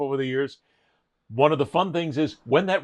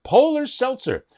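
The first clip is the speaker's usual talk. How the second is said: hyped pitch; 11 semitones higher, 4 dB louder, slower than usual. Acted. Hyping a wished-for sponsor.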